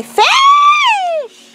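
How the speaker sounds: high, slow excited shout. Real, not acted. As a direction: squeal it out to the viewers. Excited call to viewers.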